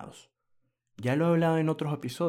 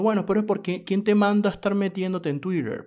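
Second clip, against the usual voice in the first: higher, louder mock scolding; mocking critic; 7 semitones higher, 5 dB louder than usual. Mocking a Twitter critic who scolds him, in a higher, louder mock scold. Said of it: "por ejemplo, te dicen".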